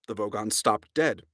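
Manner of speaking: ordinary delivery, nothing marked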